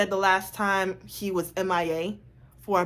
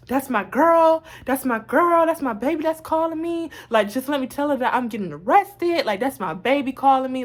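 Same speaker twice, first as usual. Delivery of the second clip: mildly hammed-up plea, higher pitched; imitated pleading; 5 semitones higher than usual. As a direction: imitate a boyfriend urgently begging to take a call. Boyfriend pleading while being arrested.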